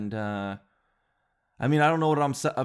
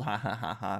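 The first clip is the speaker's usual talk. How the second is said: mock chuckle; 4 semitones lower, 6 dB quieter than usual. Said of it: "we all chuckled".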